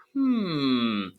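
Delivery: a small voice pondering. Acted. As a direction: hum close in his ear. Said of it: "said a small voice in his ear".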